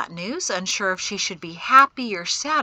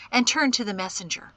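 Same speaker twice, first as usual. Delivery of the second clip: ordinary delivery, nothing marked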